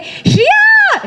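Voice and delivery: high, drawn-out excited shout; kids calling out. Retold or imitated. Kids spotting the actress at breakfast, excited shout. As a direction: squeal the name like delighted kids.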